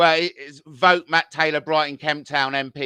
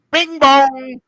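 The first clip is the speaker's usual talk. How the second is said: sung high and loud like a doorbell; ding the doorbell high and loud; playful chime announcing the liar; mock doorbell chime; 8 semitones higher, 10 dB louder than usual.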